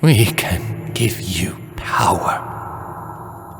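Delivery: very slow unvoiced whisper in her ear; frightened spirit whispering a temptation; scared whisper. A narrator's reading. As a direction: whisper it close, almost singing. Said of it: "the scared voice whispered".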